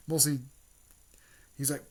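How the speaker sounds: ordinary delivery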